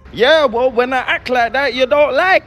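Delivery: high, insistent protest; exasperated arguing. Real, not acted. Arguing back at imagined women.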